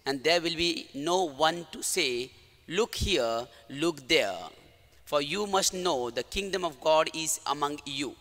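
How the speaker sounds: solemn, measured gospel proclamation; gospel reading. Acted. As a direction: read the gospel with reverence. Priest reads the gospel.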